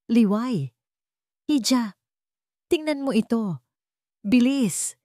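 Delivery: high, joyful calling out, voice trembling a little with glee; an old woman bursting in to share good news; excited old woman's call. Acted. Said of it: "Nanginginig ang boses ng matanda sa tuwa". (masayang pagtawag ng matanda, nanginginig sa tuwa)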